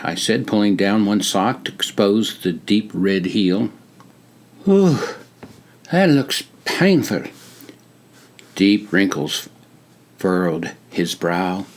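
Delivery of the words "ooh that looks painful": put-on higher British-like accent, a sympathetic ooh. A narrator's reading. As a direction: wince along in a British-like accent. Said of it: "crisp, British-like accent".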